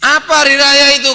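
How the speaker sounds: high, loud rhetorical question; preaching shout. Acted. Preacher voicing Sayyidina Ali's question, high and loud. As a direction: call out the question to the crowd. Sayyidina Ali asks forcefully.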